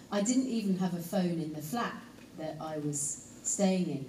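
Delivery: usual speaking voice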